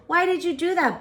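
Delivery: put-on high, wondering little-girl question; curious child. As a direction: ask grandma like a small kid. Child asks grandmother.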